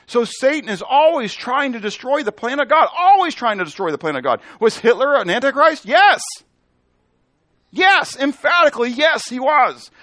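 high, loud sermon with shouted answers; emphatic preaching. Real, not acted. Preacher answering his own antichrist questions, emphatic and loud. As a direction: hammer each answer home to the room.

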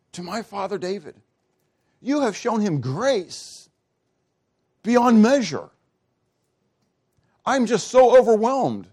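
high, fervent, breathless wonder; overwhelmed awe. Acted. Solomon overwhelmed, praising God, in high awed wonder. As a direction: pour it out in amazed gratitude.

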